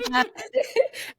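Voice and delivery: rising laugh, pitched high; real laugh. Real, not acted. Host laughing at the guest's quip about wanting their food, real laugh.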